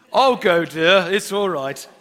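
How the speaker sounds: loud, breezy, overly helpful husband voice; mock cheerful husband. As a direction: brush her off with a cheery offer. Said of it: "I like to control".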